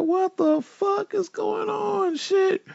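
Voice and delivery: very high, soft, slow squeaky what the fuck is going on; shocked disbelief. Retold or imitated. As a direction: squeak it out in total confusion. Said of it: "We like".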